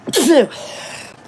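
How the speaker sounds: loud real sneeze; sneezing. Real, not acted. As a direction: let out a sudden sneeze. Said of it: "I'm gonna sneeze again".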